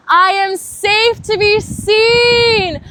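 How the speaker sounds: very high, very loud and slow; a cathartic full-voice cry; cried out. Shown as a demonstration. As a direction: belt it out from the heart. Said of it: "bringing that energy into your heart and saying".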